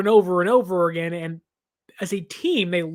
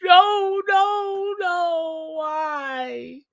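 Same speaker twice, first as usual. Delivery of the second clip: excited yeses rising high, then falling into a pained why; agonized yelling; 8 semitones higher, much slower than usual. Retold or imitated. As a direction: cheer the yeses, then crumble on the nos. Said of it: "yelling in agony".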